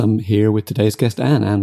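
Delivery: normal talking voice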